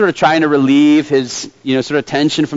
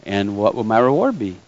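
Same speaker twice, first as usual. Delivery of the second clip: soft, low, cocky fisherman; understated cockiness, pitched down; 6 semitones lower, 5 dB quieter than usual. A narrator's reading. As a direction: needle him quietly with the question. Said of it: "And kinda cocky-like".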